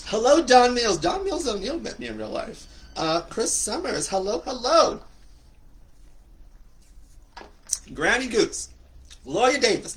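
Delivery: high, loud hellos to viewers; excited greetings. Real, not acted. The host welcomes friends joining the live chat, high and loud. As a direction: call out each name with delight.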